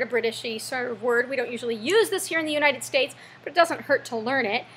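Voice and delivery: ordinary delivery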